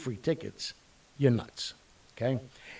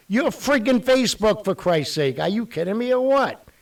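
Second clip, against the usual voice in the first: loud, high, fed-up outburst; exasperated rant; 9 semitones higher, 6 dB louder than usual. Real, not acted. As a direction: blow up at Facebook for crashing. Ranting at Facebook's servers.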